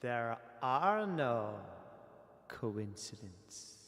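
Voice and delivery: slow hissing serpent voice; drawn-out snake voice. Pretend. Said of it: "snake voice".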